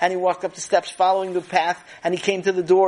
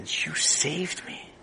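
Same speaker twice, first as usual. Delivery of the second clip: grateful thanks; 8 dB quieter, much slower than usual. Acted. Grateful manager to Sammy.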